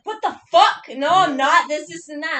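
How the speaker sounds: loud, high retold protest; imitating an indignant, shouted denial; outraged denial. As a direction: burst out in mock outrage, denying it. Retells his outraged denial.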